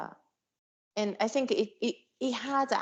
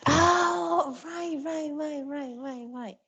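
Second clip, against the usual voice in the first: it dawns on you, high then trailing away; sudden recognition; 8 semitones higher, slower than usual. Real, not acted. Recognizes the poem being described.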